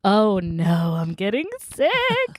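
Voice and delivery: high, drawn-out mock whine; mock wailing. Podcaster bemoans getting sick on air, mock wail. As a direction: wail it in playful dismay. Wails playfully about falling ill.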